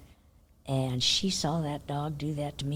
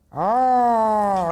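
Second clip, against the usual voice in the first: short loud growled oh, like a guard dog; cat growling at a passing stranger; imitating a cat growling like a dog; 3 semitones higher, 8 dB louder than usual. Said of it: "growl at you just like a dog".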